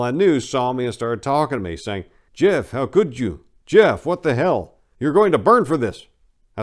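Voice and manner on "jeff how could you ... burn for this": shocked scolding. Retold or imitated. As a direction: scold him like horrified neighbours. Acquaintances confronting him.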